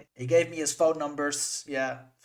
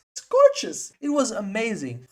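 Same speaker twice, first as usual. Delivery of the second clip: much higher and louder, near a squeal; ecstatic; 7 semitones higher, 7 dB louder than usual. Real, not acted. Vlogger raving to viewers about the head kick, ecstatic. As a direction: gush over the knockout kick.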